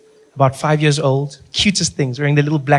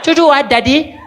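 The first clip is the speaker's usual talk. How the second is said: put-on high, excited little-kid question; mock child voice; 8 semitones higher than usual. Child asking daddy, high and eager. Kid quoted in family story.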